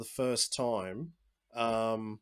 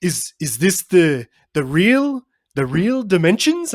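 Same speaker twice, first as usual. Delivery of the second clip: loud, high, hammed-up French accent; a deliberately terrible French accent; bad French accent; 4 semitones higher, 10 dB louder than usual. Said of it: "I'm gonna do the worst French accent".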